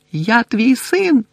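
high, slow shout; crying out. A narrator's reading. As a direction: cry to his father. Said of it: "кричав він".

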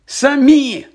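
high, loud cry on САМІ; shouted. A narrator's reading. Boy accusing the author of the books, shouting. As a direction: throw out «Самі» as an indignant shout. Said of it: "крикнув Гаррі".

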